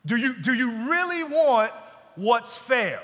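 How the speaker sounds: loud, forceful divine rebuke; God loudly rebuking those who demand fairness; stern God. Acted. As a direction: thunder back the challenge. God answering the complainer.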